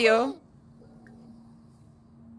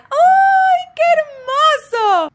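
high, loud melting cry; loud adoring coo; delighted squeal; 12 semitones higher, 6 dB louder, much slower than usual. Real, not acted. Meets the new baby.

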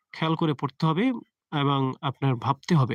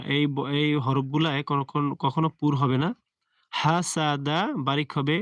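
normal talking voice

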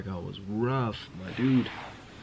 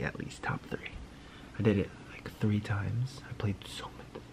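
whisper; 7 dB quieter than usual. Real, not acted. Whispering so his sleeping wife won't wake. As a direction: keep it breathy and hushed.

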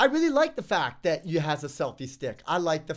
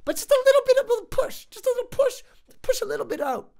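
much higher, coaxing voice; high pleading; 3 dB louder than usual. Real, not acted. Reviewer begging for a little digital zoom, higher pitched. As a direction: beg sweetly for just a tiny zoom.

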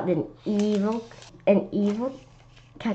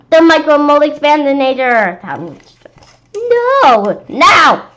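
monster demand; 7 semitones higher, 10 dB louder, slower than usual. Acted.

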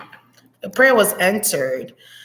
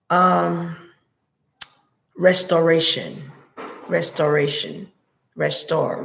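channelling messages; 4 semitones lower than usual. Acted. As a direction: intone the words as if receiving them.

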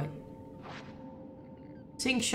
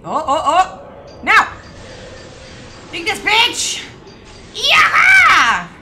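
loud, high burst of excitement; battle yell; 10 semitones higher, 12 dB louder, much slower than usual. Real, not acted. Player cheering the shot at the monster, excited battle yell. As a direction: shout in triumph as the shot lands.

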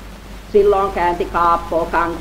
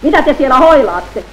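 1 fed-up call; 4 semitones higher, 5 dB louder than usual. Acted. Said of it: "kyllästyy Kaappo".

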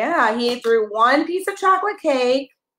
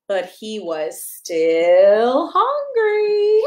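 slow, drawn-out but he was still hungry; slow storybook refrain; 3 semitones lower, much slower than usual. Acted. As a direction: stretch out the refrain for the toddler. Reading a picture book to a child.

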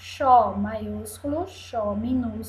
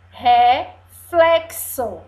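loud, slow RE... FLE-XO; syllable by syllable; 7 dB louder, much slower than usual. Shown as a demonstration. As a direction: stretch "reflexo", sounding the x as ks. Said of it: "com o som de C S". ("reflexo" forte e pausado, x com som de cs)

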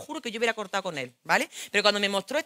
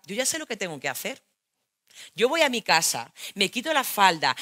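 normal talking voice

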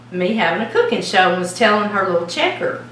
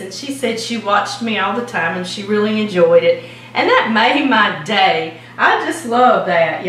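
ordinary delivery

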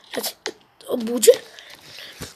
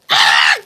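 frustrated yell; 7 dB louder than usual. Real, not acted. Character falls off ledge.

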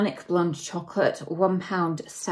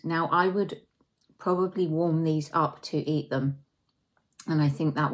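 chewing between words; talking while chewing. Real, not acted. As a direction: mouth partly full of cookie. Seen 2: Bites cookie at -5 and 4.4-5, lips pursed mid-talk; chewing likely.

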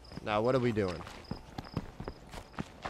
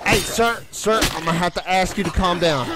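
raised, higher-pitched call to calm down; 4 semitones higher than usual. Pretend. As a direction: call out to an attacker, voice lifted. Calling to an attacking zombie to calm down.

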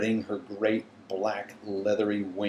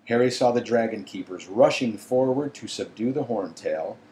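usual speaking voice

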